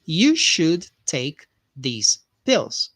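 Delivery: very slow, deliberate model sentence; careful classroom dictation pace; slow repeat-after-me. Shown as a demonstration. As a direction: drag it out so students can repeat. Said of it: "you can repeat after me here".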